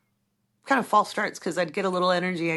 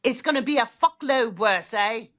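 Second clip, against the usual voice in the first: a hammed-up fake British accent, a bit louder; 5 semitones higher, 4 dB louder than usual. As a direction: play a put-on British bloke, cheeky and half joking. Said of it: "maybe I should do a British accent, like a fakey one".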